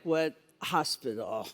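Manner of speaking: mock-skeptical aside, muttered low and soft; incredulous audience mutter. Audience member doubting the hospital, muttered skeptically. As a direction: voice the doubting listener under your breath. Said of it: "Some of you are like".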